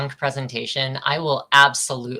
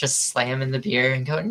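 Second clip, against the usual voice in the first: ordinary delivery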